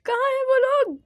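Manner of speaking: high, loud demanding shout; frustrated shout. Real, not acted. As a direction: yell out where are those people. Angry at those who advised Hindi.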